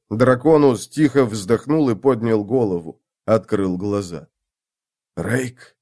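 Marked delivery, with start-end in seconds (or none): 5.1-5.8 whispered. A narrator's reading. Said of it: "шепнул он".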